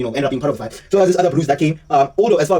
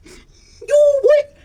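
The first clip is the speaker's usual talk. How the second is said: imitating a sobbing yell; crying cry-out; 4 dB louder than usual. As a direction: voice cracking way up on what. Sefo crying out in fight.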